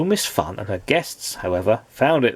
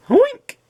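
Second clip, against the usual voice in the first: a wailing pig squeal, much higher and louder; mournful oink; 6 dB louder than usual. Acted. Embarrassed pig wails mournfully, a high squealing oink. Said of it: "wailed Mister Grumbly mournfully".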